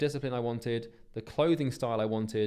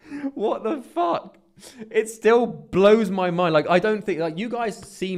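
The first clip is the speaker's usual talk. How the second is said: much higher, louder, slow amazed outburst; stunned it all actually happened; disbelief; 9 semitones higher, 10 dB louder, slower than usual. Real, not acted. Still amazed his vision came true.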